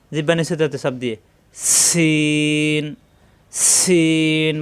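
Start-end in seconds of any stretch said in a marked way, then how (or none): 1.5-4.5 "সিন" with whistled s and long-held vowel; articulation demo. Shown as a demonstration. Teacher shows air escaping on the letter, drawn-out "সিন". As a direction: hiss into "সিন" and draw it out slowly. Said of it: "সিন উচ্চারণ".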